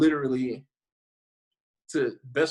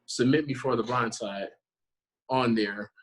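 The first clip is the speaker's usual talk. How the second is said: ordinary talk, nothing marked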